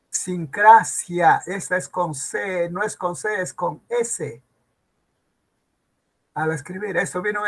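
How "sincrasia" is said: Sincrasia slow and loud, sin-CRA-sia; careful syllables. Shown as a demonstration. Teacher modelling the word, Sincrasia in slow, loud syllables. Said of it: "No es idio, es idio".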